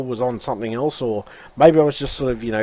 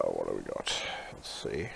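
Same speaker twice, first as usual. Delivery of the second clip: very low, barely audible mumble; absent-minded murmur; muttering to self; 17 dB quieter than usual. Real, not acted. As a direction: think aloud under your breath, fading to a whisper. Thinking to himself while searching.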